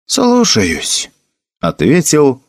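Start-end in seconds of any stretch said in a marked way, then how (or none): 0.1-1.1 put-on high-pitched, upset young soldier; obey with a touch of dismay; dejected reply. A narrator's reading. Felix accepts the order from Gilbert, upset. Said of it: "ответил расстроенный Феликс".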